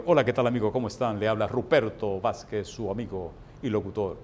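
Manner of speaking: radio announcer. Retold or imitated. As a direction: play the suave radio host, pitched low. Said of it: "Imagínate que le aparezca yo en la gente". (voz de locutor de radio, más grave y melosa)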